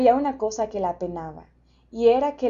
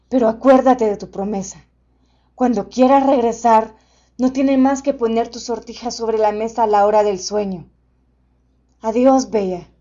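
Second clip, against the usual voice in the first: loud, booming gentle beast; friendly monster voice; 8 dB louder than usual. A narrator's reading.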